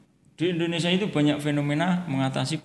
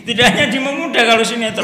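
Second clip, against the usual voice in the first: sambil tertawa; 8 semitones higher, 6 dB louder than usual. Real, not acted. Laughing about sinetron fans.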